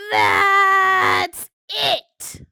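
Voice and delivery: furious shout, high and loud; angry pigeon yelling; loud outburst. Acted. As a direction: blow up at the duckling. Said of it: "His words were so loud".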